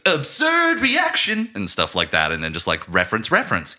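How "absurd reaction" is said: high, loud and slow, overdone; mock Britta line. Imitating a sitcom character's stock reaction, hammed up. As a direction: stretch it out like a sitcom beat. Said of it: "it cuts to Britta and she just goes".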